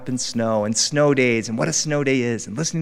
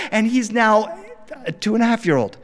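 ordinary talk, nothing marked

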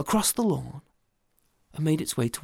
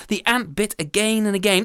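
ordinary delivery

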